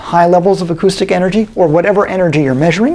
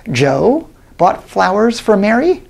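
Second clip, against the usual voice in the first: slow, with "Mary" raised and lifted at the end; stress on MARY; 4 semitones higher, slower than usual. Shown as a demonstration. Lecturer shows students emphasis moving to "Mary". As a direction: lean on "Mary", rising. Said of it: "sometimes I'm emphasizing different words".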